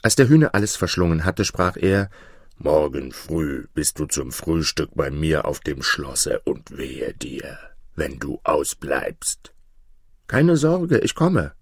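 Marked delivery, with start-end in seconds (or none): 2.6-9.5 low giant voice, slowing ominously; threatening giant. A narrator's reading. Giant summons the boy to his castle, low and menacing. 10.3-11.5 high defiant call; defiant boy. A narrator's reading. Young shepherd accepts the giant's summons, defiantly. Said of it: "rief der Junge trotzig".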